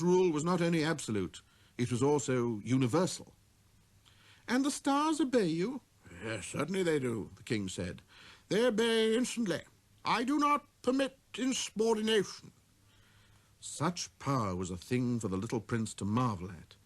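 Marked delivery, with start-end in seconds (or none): 4.5-5.8 put-on high, wondering child's voice; little prince. 6.2-12.5 proud king summoning a visitor; king's command. Acted. Voices the king.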